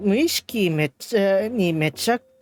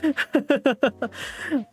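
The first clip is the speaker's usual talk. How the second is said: long amused laugh; burst of laughter; 6 semitones higher than usual. Real, not acted. Streamer cracking up over the wrong dialect. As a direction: crack up at the mix-up.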